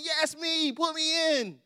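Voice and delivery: a hint of a pleading kid waving for the coach; eager kid on the bench. Benchwarmer kid calls to the coach, lightly pleading. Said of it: "I'm at the end of the bench saying".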